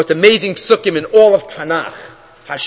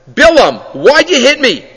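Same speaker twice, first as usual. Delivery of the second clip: higher, plaintive donkey's protest; mild aggrieved complaint; 6 semitones higher, 4 dB louder than usual. Acted. Donkey complaining to Bilam about being hit, aggrieved. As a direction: call him out, a little hurt and raised in pitch.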